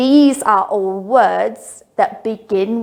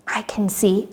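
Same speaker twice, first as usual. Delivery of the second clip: whisper; 6 dB quieter than usual. Acted. Game reveal whisper.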